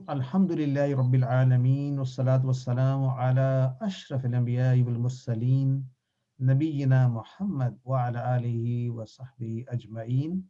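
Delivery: measured, slowed Arabic praise formula; formal opening invocation. Acted. Teacher opens the final lesson with a slow, formal Arabic invocation. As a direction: recite the opening blessing with ceremony.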